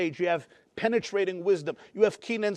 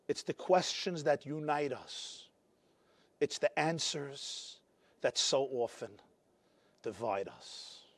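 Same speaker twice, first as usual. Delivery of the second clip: hushed wise teaching; 8 semitones lower, 14 dB quieter, slower than usual. Retold or imitated. Rebbe's wisdom, softly delivered.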